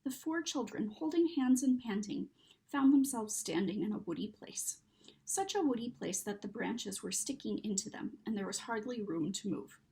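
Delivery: ordinary delivery, nothing marked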